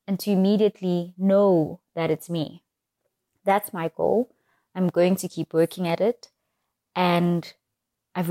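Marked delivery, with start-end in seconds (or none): none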